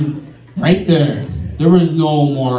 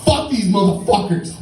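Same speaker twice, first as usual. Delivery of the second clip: higher-pitched retold cursing; indignant outburst; 7 semitones higher than usual. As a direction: curse the cabs, pitch raised, imitating a friend. Acts McLaughlin's anger at cabs.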